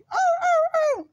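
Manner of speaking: loud, held mock barking howl; ladrido de perro. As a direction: imitate a dog barking loudly. Imita el ladrido del perrito. (ladrido imitado, fuerte y sostenido)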